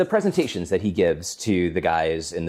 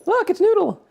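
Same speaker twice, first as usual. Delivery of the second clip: silly high voice; 4 dB louder than usual. Pretend. Introducing co-host on camera.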